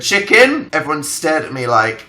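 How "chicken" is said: sudden loud high blurt; blurted word. Retold or imitated. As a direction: blurt it out of nowhere. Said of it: "So I literally just went".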